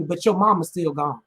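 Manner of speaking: ordinary talk, nothing marked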